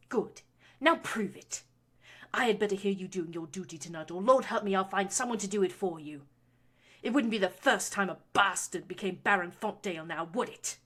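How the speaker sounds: loud, cold menace; threatening. A narrator's reading. Baroness threatening her son over an heir. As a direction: lean into the threat.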